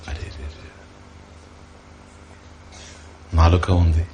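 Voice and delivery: acting out someone whose voice box has gone mute; a breathless struggle to get words out; voice failing. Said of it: "స్వరపేటిక మూగబోయి".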